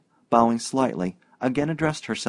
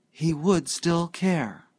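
slow, higher, reluctant admission; 7 semitones higher, much slower than usual. Acted. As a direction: drag each word out as if unwilling to say it. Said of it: "with a kind of reluctance".

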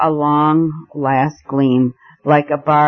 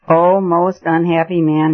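ordinary delivery

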